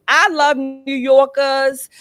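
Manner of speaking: drawn-out, playful fake New York accent. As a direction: put on a mock New York accent, stretched slow. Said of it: "with my fake New York accent".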